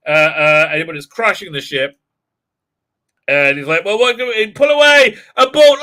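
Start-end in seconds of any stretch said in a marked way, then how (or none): 3.8-5.8 high, loud flustered order; mock panic, barking to abort; captain shouting in a hammed-up panic to abort; panicked captain. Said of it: "look at the panic on this woman's face".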